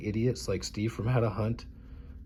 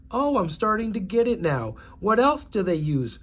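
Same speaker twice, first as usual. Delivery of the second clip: higher, faux-surprised; mock naive listener; 6 semitones higher than usual. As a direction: play dumb discovering the plot. Mock naive realization dialogue.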